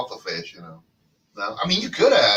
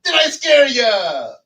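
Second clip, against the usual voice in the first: high, loud, slow ghoul voice; spooky voice; 12 semitones higher, 7 dB louder, slower than usual. Pretend. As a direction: try to scare them, drawn out. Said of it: "no one's scared".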